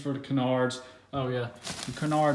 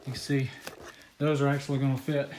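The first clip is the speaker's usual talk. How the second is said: usual speaking voice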